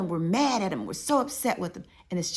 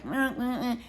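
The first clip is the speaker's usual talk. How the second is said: muffled wordless nagging, pitched up; Charlie Brown's mama; 5 semitones higher, slower than usual. Retold or imitated. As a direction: mimic a cartoon adult's wordless drone. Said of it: "sound like Charlie Brown's mama".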